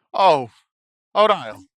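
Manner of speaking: jolted, blurting out the name; startled. Acted. Startled by knock, recognizes friend.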